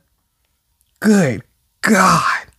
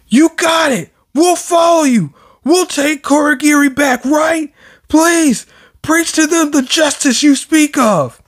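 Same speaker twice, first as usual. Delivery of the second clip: hammed-up zealot voice, pitched high; eager follower shout; 9 semitones higher than usual. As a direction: shout it like a devoted fan. Voicing eager villain followers.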